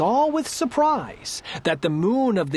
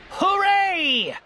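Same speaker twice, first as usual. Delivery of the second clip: high-pitched jubilant cheer; triumphant shout; 9 semitones higher than usual. A narrator's reading. Turtle king perched high in the sky, jubilant shout. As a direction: cry out in victory. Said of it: "shouted Yertle".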